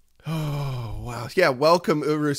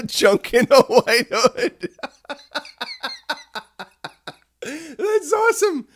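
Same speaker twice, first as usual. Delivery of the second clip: slow, high, wheezing through laughter; words squeezed out between laughs; laughing; 6 dB louder, slower than usual. Real, not acted. Reacting to viewers' shirt idea, still laughing.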